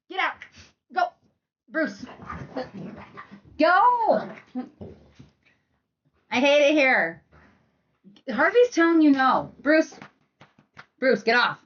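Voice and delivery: high, loud scolding at the dogs; loud sharp voice. Real, not acted. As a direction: snap at the dogs to stop. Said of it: "My mom voice is about to come out".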